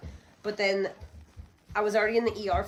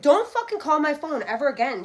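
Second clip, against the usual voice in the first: sharp, loud and higher, telling the caller off; retold angry yell; 7 semitones higher, 8 dB louder than usual. Hanging up on the caller, loud angry yell. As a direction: snap it at the caller like you mean it. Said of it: "And I said".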